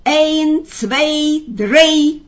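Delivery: high, loud countdown; ringing, chanted count; shouted command. A narrator's reading. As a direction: count it out like a circus magician, building on the last. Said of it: "закричал он коту".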